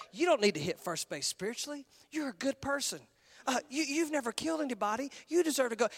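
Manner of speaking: a hint of a soft, coaxing devil; Satan's tempting voice. Preacher voicing Satan tempting the congregation, softly coaxing. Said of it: "He's gonna say".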